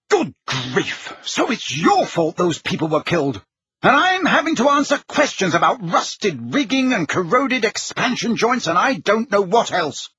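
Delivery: indignant outburst, climbing high in pitch; furious. A narrator's reading. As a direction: build from shock into a high, angry complaint. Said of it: "said the Prime Minister furiously".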